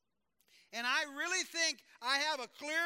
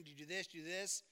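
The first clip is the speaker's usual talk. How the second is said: soft probing question; 6 semitones lower, 7 dB quieter, faster than usual. Retold or imitated. Friend gently probing the pastor.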